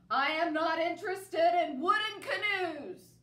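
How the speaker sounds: loud, high, indignant declaration. Acted. Bear scorns the canoe book, very loud and indignant. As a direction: boom it out, offended. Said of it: "Bear's voice was even louder".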